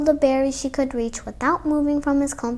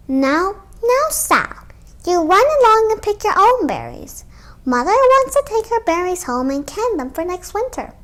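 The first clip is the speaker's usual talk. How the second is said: a hint of a higher, chiding mother; lightly voiced mother; 6 semitones higher, 3 dB louder than usual. Mother sending little Sal off to pick her own, mildly chiding. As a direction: shoo the child off gently.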